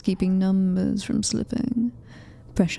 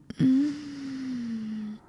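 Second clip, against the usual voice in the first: a short, soft, higher gloating chuckle; soft gloating laugh; 4 semitones higher, 5 dB quieter than usual. Acted. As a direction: laugh quietly over the captured prey. Queen gloats over claimed captive.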